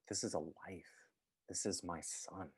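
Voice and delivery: hushed, reverent whisper; awed whisper. Retold or imitated. Father whispering over his newborn son, awed. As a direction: breathe it like holding something precious.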